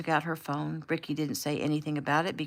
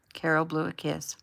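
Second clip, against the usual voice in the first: ordinary delivery, nothing marked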